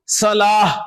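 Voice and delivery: صلاح drawn out to an H ending; final H sound. Shown as a demonstration. Teacher confirming the student's reading, slow صلاح with final H. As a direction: stretch صلاح and close it on H, no T. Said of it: "صلاح پڑھیں گے نا کہ صلاۃ".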